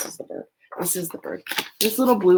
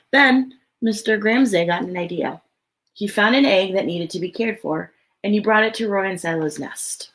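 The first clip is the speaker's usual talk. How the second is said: lively, expressive storybook read; reading to children; 2 semitones higher, 5 dB louder than usual. Acted. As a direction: read it out to a room of little kids. Reads picture book aloud.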